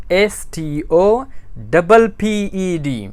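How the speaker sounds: very slow letter-by-letter dictation; slow spelling. Shown as a demonstration. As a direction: spell it out slowly so everyone writes it down. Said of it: "stop की spelling क्या होगी". (बहुत धीरे spelling)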